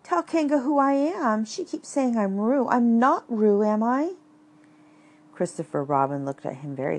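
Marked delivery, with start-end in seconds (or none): 0.0-4.1 high, pleading little voice; squeaky Piglet voice. Acted. Piglet pleading to be recognized.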